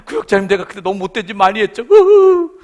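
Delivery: loud, whining mock sob; a young member's hammed-up weeping apology to her leader; mock tearful confession. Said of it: "계속 눈물 콧물 쏟으면서".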